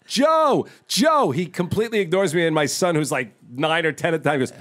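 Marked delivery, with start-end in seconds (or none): 0.1-1.3 calling out. Retold or imitated. Calling out to Pesci jogging.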